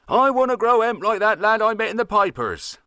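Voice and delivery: high mimicking young lad's voice; imitating grandson. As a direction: farmer mocks his grandson, pitched high. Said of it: "says he".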